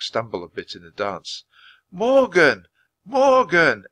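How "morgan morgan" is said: loud, high shout of a name. A narrator's reading. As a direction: keep shouting the name at him. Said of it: "Annie shouted".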